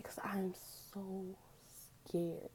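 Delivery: breathy hushed whisper, as if still frightened; scared whisper. Real, not acted. To her viewers, remembering the surgery, a scared whisper. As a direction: let the fear drop the voice to a whisper. Admitting fear during the surgery.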